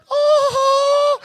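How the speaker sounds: very high, loud gasp of awe; amazed shriek. Retold or imitated. Seventh grader in the audience, shrieking in amazement. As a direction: shriek like a wowed kid.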